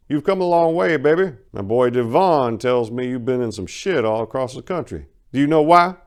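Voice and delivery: loud, high, taunting crime boss; Duke Willie's voice. Acted. Crime boss taunting Maria, high and loud. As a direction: lord it over the girl who crossed his boys. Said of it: "in his voice".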